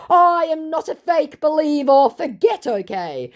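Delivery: very high mock protest; hammed-up denial, falling away at the end; indignant scammer. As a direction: play an affronted scammer, high-pitched.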